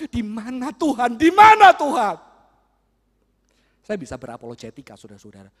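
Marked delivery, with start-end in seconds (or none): 0.1-2.2 put-on a boy's anguished, drawn-out plea; desperate cry. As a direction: cry out where God is.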